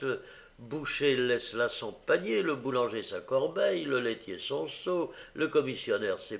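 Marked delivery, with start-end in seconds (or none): none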